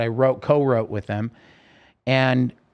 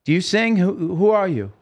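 higher-pitched imitation of a puzzled questioner; mildly incredulous questioning; 8 semitones higher, 4 dB louder than usual. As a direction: voice a baffled stranger quizzing someone. Man with pass questioning him.

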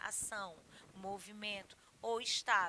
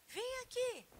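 high-pitched, unforceful call over; convite, não ordem; 13 semitones higher, slower than usual. Shown as a demonstration. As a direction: invite someone over in a high, light voice. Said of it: "mas eu não estou ordenando". (chamado agudo, sem tom de ordem)

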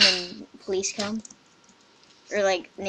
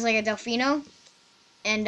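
ordinary delivery

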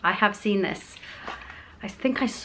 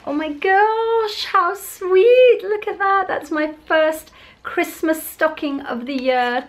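much higher, gushing and drawn out over the gift; delighted squeal; 9 semitones higher, 4 dB louder, slower than usual. Real, not acted. Opening a Christmas stocking from Ultra Sun, delighted squeal. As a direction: squeal with delight at a sweet surprise.

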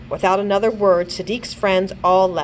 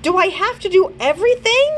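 frustrated outburst; 13 semitones higher, 5 dB louder than usual. A narrator's reading. Frustrated boy stomps foot.

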